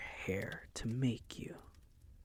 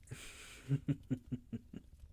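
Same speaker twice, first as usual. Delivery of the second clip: whispered chuckle; 3 semitones higher than usual. Acted. Vampire gloats over struggling maid.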